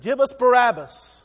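preacher voicing the mob crying for Barabbas, loud; crowd demanding. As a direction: bellow it like a baying crowd.

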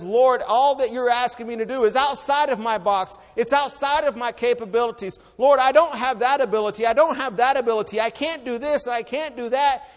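loud, high pleading as Moses; preaching at full force. Acted. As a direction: protest your weakness hard. Moses protesting his limits.

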